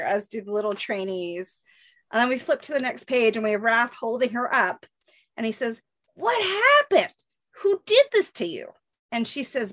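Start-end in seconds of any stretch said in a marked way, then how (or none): 6.1-8.7 high worried demand; urgent, rising alarm. Acted. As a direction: demand who hurt her, shaken. Raph alarmed.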